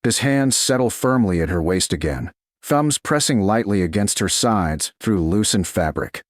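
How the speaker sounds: ordinary delivery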